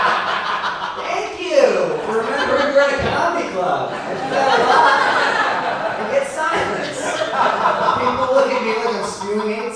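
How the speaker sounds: high, loud, laughing sarcasm; laughing at the crowd. Real, not acted. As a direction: laugh it off at the audience. Mocking the silent crowd.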